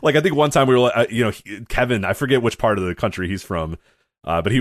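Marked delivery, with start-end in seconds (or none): none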